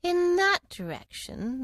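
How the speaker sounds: slow, drawn-out Cheshire Cat; slow sly cat voice. Acted. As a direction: purr it out slowly like a grinning cat. Cat character voice.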